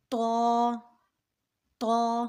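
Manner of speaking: طا as heavy t with rounded "aw"; thick t. Shown as a demonstration. Teacher modelling the letter for learners, طا thick. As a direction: make طا full-mouthed, "taw" held long. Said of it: "طا کو پڑھیں گے موٹا".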